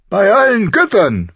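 high, loud exultant curse; laughing oath. A narrator's reading. King elated after a hard ride, laughing oath. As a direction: burst out with a jubilant oath. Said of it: "fluchte er lachend".

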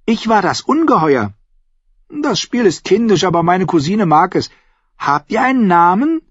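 put-on high child's voice; high-pitched little lord; boy explaining. A narrator's reading.

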